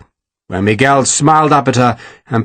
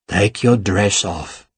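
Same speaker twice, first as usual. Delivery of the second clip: low, slow, softer command; a cold, quiet order; low menacing villain; 6 semitones lower, 5 dB quieter, slower than usual. A narrator's reading. Ferragus orders Sarah.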